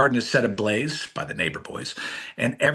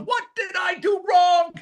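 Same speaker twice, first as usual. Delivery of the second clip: much higher hammed-up wail of a mother; mock mom anguish. Mom at the table with her sons, hammed-up anguished cry. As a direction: imitate mom's anguished cry. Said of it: "my mom ... going".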